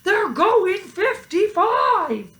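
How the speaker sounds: high, loud, slow yell; sheriff yelling. A narrator's reading. Sheriff yelling to the deputy, high and loud. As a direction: sheriff insisting the cows can drive. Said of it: "Sheriff yelled".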